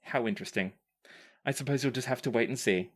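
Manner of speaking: usual speaking voice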